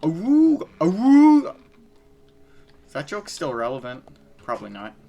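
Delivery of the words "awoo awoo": high, loud cartoon wolf howl; howling like a wolf pup. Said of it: "Jaw drops to floor".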